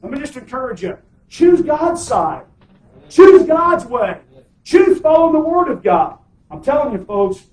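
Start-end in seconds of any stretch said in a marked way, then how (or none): 0.0-6.2 fiery preaching. Acted. Preacher urges congregation loudly.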